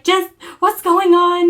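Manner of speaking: high, loud, slow demand; retold alarmed, drawn-out question; very concerned mom. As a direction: plead for an answer as a worried mother. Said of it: "she was very concerned".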